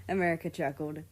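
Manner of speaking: plain reading voice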